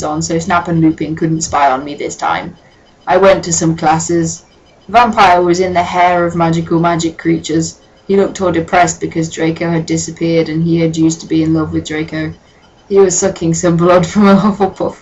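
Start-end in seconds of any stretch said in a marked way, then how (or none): none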